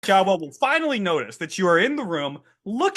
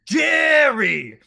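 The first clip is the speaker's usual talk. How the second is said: higher, louder crowd yell; crowd shout; 9 semitones higher, 6 dB louder than usual. Acted. Crowd of goblins shouts name.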